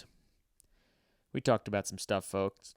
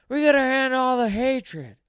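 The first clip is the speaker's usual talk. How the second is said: much higher, loud mock speech yell; yelled speech line; 14 semitones higher, 10 dB louder than usual. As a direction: shout the slogan like a rally speech, even the key words. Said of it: "he'll yell in a speech".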